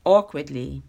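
ordinary delivery

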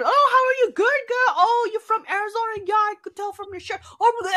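bright, fast, chirpy small talk; a hint of an eager coworker; chatty coworker voice.

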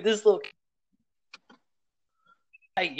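ordinary talk

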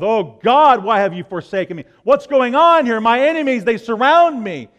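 loud, high desperate lament; anguished psalm cry. Retold or imitated. Preacher voicing the psalmist crying out to God in distress. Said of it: "they start off with".

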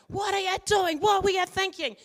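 mock a frazzled parent telling off a kid; hammed-up parent demanding answers; exasperated parent. Parent scolding a panicking kid.

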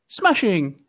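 put-on high posh English accent; mock English accent. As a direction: toff English exclamation. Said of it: "shite English accent".

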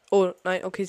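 ordinary delivery

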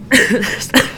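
harsh coughing; real cough mid-sentence; coughing fit. Real, not acted. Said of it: "Sorry, I'm coughing a lot".

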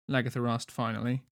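ordinary delivery, nothing marked

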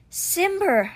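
high call, falling away; breathed cry of relief. A narrator's reading. Boy greets his returned stone cheetah, breathed cry. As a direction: cry the beloved name out in relief. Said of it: "Alex breathed".

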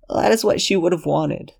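put-on high, pleading young man's voice; desperate Lexington.

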